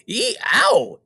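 high pained yelp; wolf yelping, burned in the pot; wolf's scream. A narrator's reading. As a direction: yelp as if scalded. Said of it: "with a scream".

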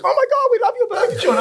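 put-on squealing, high-pitched fan girls with a laugh; hammed-up excited girl voice; gushing girls. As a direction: gush like excited young women, voice way up. Girls gushing over burger joint.

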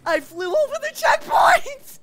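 high, loud frustrated wail; mad. Real, not acted. Player who flew over the checkpoint, mad wail. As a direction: cry out at the unfairness. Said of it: "I'm so mad right now".

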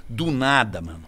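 normal talking voice

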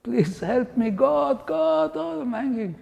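high, desperate cries to God; shrill, frantic calls of a man about to fall; panicked screaming prayer. Retold or imitated. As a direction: plead for help while dangling off a cliff. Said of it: "he started screaming".

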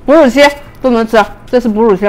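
talking between bites; chewing while talking. Real, not acted. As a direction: mouth full of corn. Seen 2: Bites corn just before; talks with food in mouth throughout.

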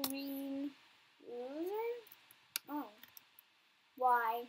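ordinary delivery

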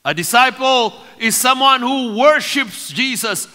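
high, forceful preacher's voice; impassioned preaching. Real, not acted. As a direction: build in driving, repeated phrases to the congregation.

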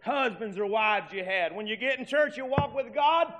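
high, loud preacher's exhortation; rising preacher's cry; full-force preaching. Real, not acted. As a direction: drive it home to the congregation, pitched high.

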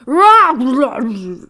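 very loud roar that fades; exploding roar. Acted. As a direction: roar into the water. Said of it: "Lion exploded with a roar".

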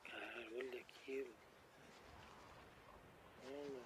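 hushed, frightened whispering; quiet and tense, barely breathing; scared horror game streamer. Real, not acted. (streamer com medo sussurrando)

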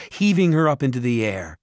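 usual speaking voice

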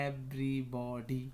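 everybody drawn out as ev-ry-bo-dy; very slow, each syllable of everybody stretched. Shown as a demonstration. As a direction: sound out everybody part by part for learners. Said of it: "এইভাবে উচ্চারণ করলাম".

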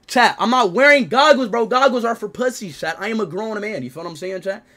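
normal talking voice

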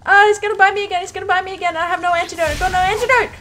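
high, loud frantic panic; panicked. Real, not acted. Player panics about being bitten again, frantic. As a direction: talk fast in real fear.

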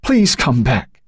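high, slow, despairing plea; anguished mother begging; voice breaking. A narrator's reading. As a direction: drag out the plea as the voice gives way. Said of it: "her own voice breaking now".

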